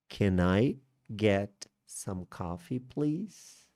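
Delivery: "Can I... get... some coffee, please?" spaced out, rising on "please"; slow polite request with lifted "please"; slow teaching repeat. Shown as a demonstration. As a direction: model it slowly, chunk by chunk. Teacher repeats model phrase slowly.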